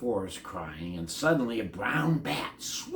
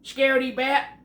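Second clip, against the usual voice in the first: high loud triumphant shout; 13 semitones higher, 9 dB louder than usual. A narrator's reading. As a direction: yell after the fleeing bat. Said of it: "Ben shouted after".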